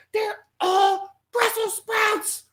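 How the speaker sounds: very high, drawn-out squeal of outrage; mock horrified mom. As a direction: stretch out the mother's appalled discovery. Voices mother's final discovery.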